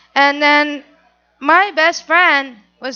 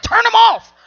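higher and louder, "Turn 'em off" called out; playful command; 7 semitones higher, 7 dB louder than usual. Retold or imitated. Telling her friend to turn off the lights, loud playful call. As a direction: bark it out happily.

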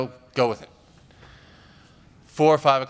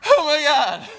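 a much higher, louder shocked cry; horrified gasp; 13 semitones higher, 7 dB louder, slower than usual. Retold or imitated. Comic quoting his horror when she remembers nothing, high loud cry. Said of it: "And I'm just like".

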